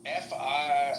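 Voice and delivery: ordinary talk, nothing marked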